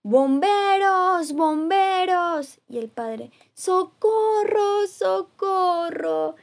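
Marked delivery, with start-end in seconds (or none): 0.0-2.6 loud, drawn-out calls for help; shout. Acted. Mother shouting for the firemen from the ground, loud and drawn out. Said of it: "Y gritó". 3.5-6.3 high, loud, drawn-out call; cry for help. Acted. Father clinging to the tree, yelling high and loud for help.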